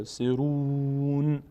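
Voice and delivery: سرون very slow, ending on a stopped ن; pause with sukun. Shown as a demonstration. Teacher shows stopping on سرون. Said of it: "من با ساکن بشه".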